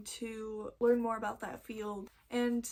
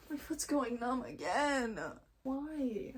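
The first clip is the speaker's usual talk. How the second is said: slow, drawn-out whine; exasperated little whine; whining complaint; slower than usual. Real, not acted. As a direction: groan it out slowly in dismay. Complaining as foot goes numb again.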